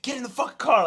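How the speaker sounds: loud, high shout imitating an angry driver; retold furious roadside shout; angry yell. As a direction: yell it at her, high and hard. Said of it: "like, yelling at her".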